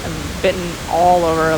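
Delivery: ordinary delivery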